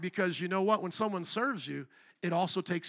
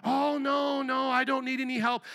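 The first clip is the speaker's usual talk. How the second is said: mock self-abasing refusal; 5 semitones higher than usual. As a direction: wave off help in a put-on high voice. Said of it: "I've seen Christians fall into both of those ditches".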